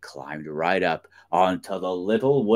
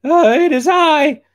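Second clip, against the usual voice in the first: very high, loud little-goat squeal; loud squeaky kid goat; little billy goat; 9 dB louder than usual. A narrator's reading. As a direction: answer as a tiny goat, pitched way up. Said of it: "the goat replied, trying not to breathe".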